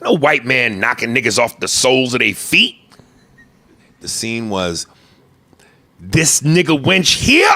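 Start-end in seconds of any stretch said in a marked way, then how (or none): 6.0-7.5 slow, drawn-out menacing quote; quoting the line. Retold or imitated. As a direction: drag out the question, here rising. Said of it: "That's the way he says it".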